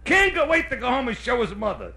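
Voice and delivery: ordinary delivery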